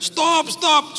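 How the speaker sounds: high, loud call to stop; retold shout. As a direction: yell at the driver to stop. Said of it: "semua orang teriak-teriak". (teriakan keras menyuruh berhenti)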